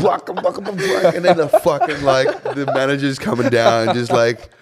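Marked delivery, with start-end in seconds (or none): 0.0-1.2 high, laughing imitation of a thumping beat; mock beat noise. The DJ mimics the track he played, laughing. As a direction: bounce the sound out like the drop.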